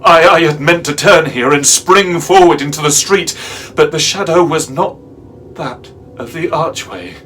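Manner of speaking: loud, high burst of panic; alarmed narration. A narrator's reading. Narrator meaning to spring into the street, alarmed. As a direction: stammer in, then push it out urgently. Narrator meant to flee, trapped.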